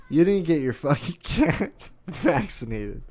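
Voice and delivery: laughing disbelief. Real, not acted. Scolding friend about the cat, laughing disbelief. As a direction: laugh helplessly through the scolding.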